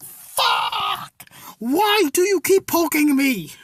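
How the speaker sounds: high, loud, slowed furious yelp; high pained yell dragging into a slow demand. Retold or imitated. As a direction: shout it out, fed up with being poked. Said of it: "He's like".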